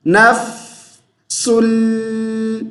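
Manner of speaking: نفس ال with the fa held long and still; held sakin fa. Shown as a demonstration. Teacher demonstrating, slow held fa. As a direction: hold the fa in نفس without bounce. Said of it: "فا کو ساکن کی حالت میں لمبا کر دیجئے".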